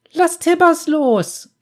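high, loud, slow cry; girl's shriek. A narrator's reading. Girl cries at the bounty hunter to release her bear, high loud shriek. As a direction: shriek it out like a frightened child. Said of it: "schrie das Mädchen auf".

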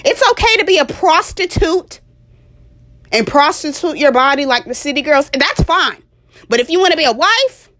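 high, loud sarcastic parody of enabling advice; exaggerated high voice of a permissive adviser; mocking reassurance. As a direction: mimic the cheerleaders in a sugary high voice.

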